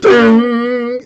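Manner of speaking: long, high, ringing metallic note; imitated ringing ding. Game master voicing the armor's impact, sustained high ding. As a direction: strike the ding and let it ring.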